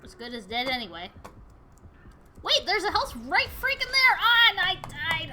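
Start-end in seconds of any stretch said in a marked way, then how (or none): 2.4-5.3 high, loud cry of frustration; exasperated outburst. Real, not acted. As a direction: wail at a maddening near miss. Player dies beside health pickup.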